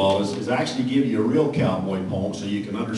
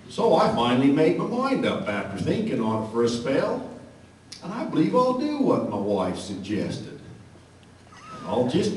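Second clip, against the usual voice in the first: cowboy poem recitation; 4 semitones higher than usual. Acted.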